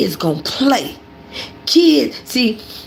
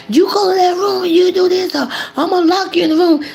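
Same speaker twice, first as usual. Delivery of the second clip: higher, whiny mock threat; mock nagging mom; 10 semitones higher than usual. Mimicking a mom ordering kids to clean, high mock threat. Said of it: "without saying".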